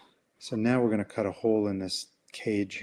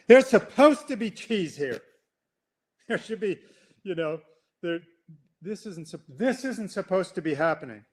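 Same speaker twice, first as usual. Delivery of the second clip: high, indignant whine; complaining human; 7 semitones higher than usual. Pretend. Human complaining to the management, high whine. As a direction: whine that this isn't fair. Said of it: "complain to the management".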